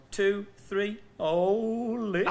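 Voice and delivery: crooning the number like a song hit. Retold or imitated. To the class at register, crooned warble. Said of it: "With a bit of a [name] warble".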